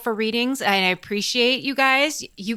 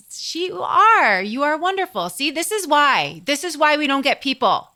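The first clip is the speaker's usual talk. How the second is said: raised pitch, emphatic scolding; exasperated bickering; 6 semitones higher, 3 dB louder than usual. Real, not acted. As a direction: insist hard, fed up with being interrupted. Playful bickering between the two hosts.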